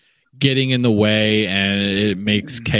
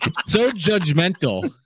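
laughing protest; 5 semitones higher, slower than usual. Real, not acted. Playful protest at teasing.

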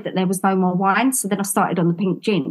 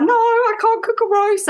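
much higher, tearful protest; meltdown wail; 13 semitones higher than usual. Retold or imitated. Refusing husband's roast in a meltdown, high and tearful. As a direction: wail it like you're falling apart. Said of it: "I was in such a meltdown".

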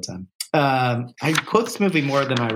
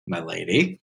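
a lower, smooth gallant greeting; quoting the charming villain in a low voice; 1 suave movie hero; 8 semitones lower than usual. Retold or imitated. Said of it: "looks at Mary and goes".

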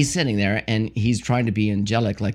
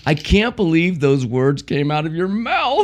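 high, slow, scandalized scolding; drawn-out, raised-pitch astonishment; mock disbelief; 5 semitones higher, slower than usual. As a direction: play up shock at a child's language. Acting out scandalized grandpa scolding grandson.

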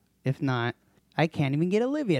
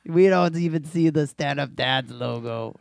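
mock complaint; 4 semitones higher, 7 dB louder than usual.

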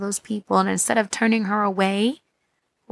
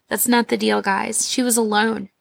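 ordinary talk

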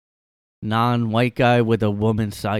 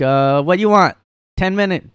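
higher, louder, imitating a Chinese restaurant worker's accent; mock Chinese accent; 9 semitones higher, 8 dB louder than usual. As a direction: mimic the clipped English of a Chinese restaurant cashier. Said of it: "they talk like that".

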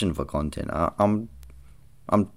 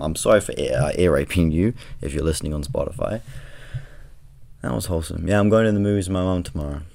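usual speaking voice

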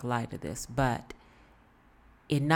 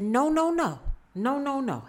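much higher and louder rapid no's; insistent protest; 10 semitones higher, 5 dB louder than usual. Retold or imitated. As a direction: cut her off sharply with repeated no. Lady objecting to queue jumping.

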